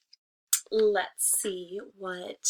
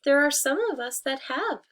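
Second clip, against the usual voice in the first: much higher, louder sly tree voice; 6 semitones higher, 6 dB louder than usual. Acted. Disguised wolf answering the hen, high and sly. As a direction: sing it out sweetly, hiding the wolf. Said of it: "said the tree".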